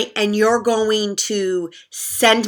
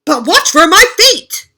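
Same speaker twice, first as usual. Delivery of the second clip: high, loud warning; 8 semitones higher, 10 dB louder than usual. Acted. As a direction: warn them loudly. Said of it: "said Moose".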